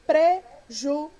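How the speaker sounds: "prejuízo" loud and slow, one syllable at a time; separação silábica. Shown as a demonstration. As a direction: chop "prejuízo" into PRE, JU, Í, ZO with gaps. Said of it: "como se divide a palavra prejuízo?". ("prejuízo" bem pausado e forte, sílaba por sílaba)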